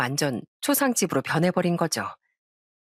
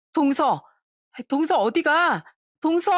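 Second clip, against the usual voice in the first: higher-pitched acted call after her; calling after someone; 7 semitones higher, slower than usual. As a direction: call out to stop someone leaving. Calling after departing sister-in-law. (떠나는 사람을 다급히 부르듯)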